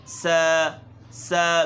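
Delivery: ثا turned into سا with a whistle, the mistake; whistled tha. Shown as a demonstration. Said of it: "سیٹی کی آواز پیدا کرتے ہیں". (سیٹی والی غلط ادائیگی)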